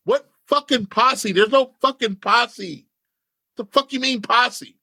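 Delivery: high-pitched, drawn-out mock outrage; acted-out exasperated questioning, slow and high; indignant disbelief. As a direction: replay an incredulous protest, stretching the words. Acted indignant reply to a friend.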